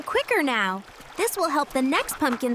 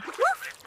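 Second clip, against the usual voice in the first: two quick barks, much higher; dog barks; 13 semitones higher, 3 dB quieter than usual. Acted. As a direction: yap twice like a happy puppy.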